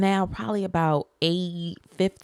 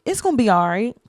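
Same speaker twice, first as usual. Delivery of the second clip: reassuring; 6 semitones higher, 4 dB louder than usual. Retold or imitated. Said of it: "And he's like".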